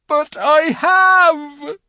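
loud, high, drawn-out cry; anguished wail. A narrator's reading. Faun contradicting Lucy's trust, wailing in guilt. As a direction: howl the admission slowly.

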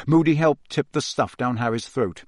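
plain reading voice

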